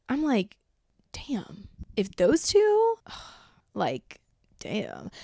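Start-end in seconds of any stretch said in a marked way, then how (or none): 1.1-5.1 whispered disbelief. Retold or imitated. Inner reaction to breakup news.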